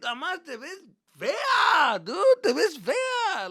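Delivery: put-on high, loud, drawn-out teenage whine; acted exasperated complaint. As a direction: wail the insult at mom, slowly. Acted teen insulting mother's look.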